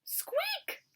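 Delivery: high whispered squeak then loud cry; tiny mouse in panic; squeaky mouse yell. A narrator's reading. Mouse cries as the tusk breaks, high squeak. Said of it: "Mouse yelled".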